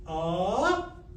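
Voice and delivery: rising demo pitch. Shown as a demonstration. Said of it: "Can you identify the same pitch compared to up or down?".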